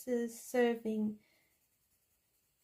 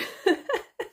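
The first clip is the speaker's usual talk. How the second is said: a loud, high squeal of a laugh; high burst of laughter; 9 semitones higher, 6 dB louder than usual. Real, not acted. Laughing at her own silly fish romance. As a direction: let the giggle burst out.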